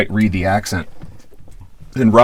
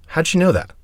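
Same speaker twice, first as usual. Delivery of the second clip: high-pitched curious question, imitated; retold puzzlement; 8 semitones higher, 4 dB quieter than usual. Roger cocks his head at the young DJ, high puzzled question. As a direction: ask it high, as if caught by surprise. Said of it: "cocks his head and looks at me and goes".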